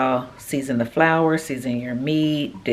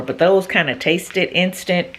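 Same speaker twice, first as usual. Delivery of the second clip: ordinary talk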